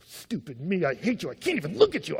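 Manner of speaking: hammed-up self-loathing rant; exaggerated disgust at yourself; mock self-beating. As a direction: berate yourself in a put-on voice. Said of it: "get the bat out and just go".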